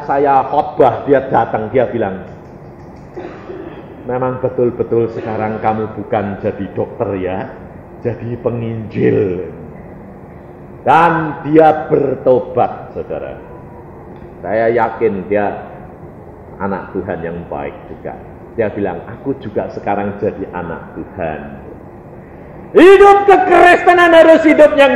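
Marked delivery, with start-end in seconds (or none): 4.1-9.5 low, soft, earnest admission; pengakuan pelan. Retold or imitated. Acts friend's subdued realisation. 19.1-21.4 low, hushed confession; pengakuan lirih. Retold or imitated. Acts friend's confession.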